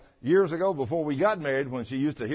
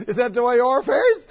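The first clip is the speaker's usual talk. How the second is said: high, loud teasing wife imitation; mock scolding wife; 9 semitones higher, 11 dB louder, faster than usual. Preacher imitating his wife's high mocking scold.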